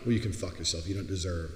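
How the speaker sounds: ordinary talk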